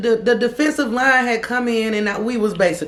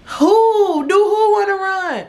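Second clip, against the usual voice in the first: shrill, much higher shocked disbelief; incredulous; 13 semitones higher, 4 dB louder, slower than usual. Retold or imitated. Teller shocked at her ex's plan to run, shrill disbelief. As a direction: squeak it out in outraged disbelief.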